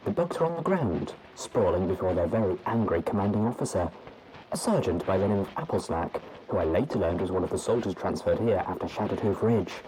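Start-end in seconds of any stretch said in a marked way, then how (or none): none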